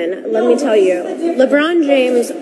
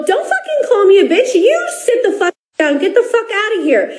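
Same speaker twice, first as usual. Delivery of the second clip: higher heated yell-back with a laugh inside; retold furious comeback; 7 semitones higher than usual. Wife replaying her courtside comeback at LeBron, higher laughing heat. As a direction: fire it back, half laughing at the memory.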